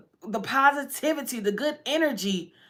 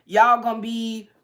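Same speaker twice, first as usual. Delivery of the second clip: normal talking voice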